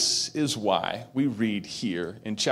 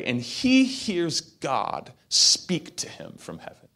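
ordinary delivery

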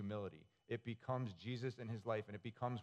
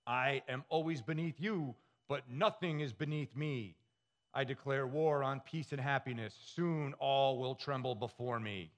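the Underminer; 2 semitones higher, 4 dB louder than usual. Acted. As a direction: proclaim it like a comic-book supervillain. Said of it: "and he yells".

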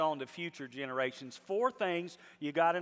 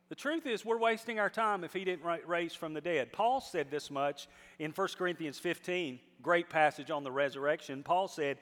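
normal talking voice

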